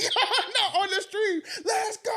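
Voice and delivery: high hyped cry of victory; shouting as the stream erupts. Retold or imitated. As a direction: yell it like you just won. Stream going crazy.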